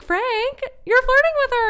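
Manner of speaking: high, loud mock accusation; hammed-up, high-pitched busting; teasing accusation. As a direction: call out a boyfriend in a high voice. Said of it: "she's like".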